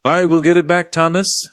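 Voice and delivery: fierce. A narrator's reading. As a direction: fiercely vow to win it back. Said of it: "John said fiercely".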